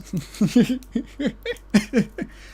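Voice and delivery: squeaky burst of laughter; high giggle. Real, not acted. Laughing at the crash.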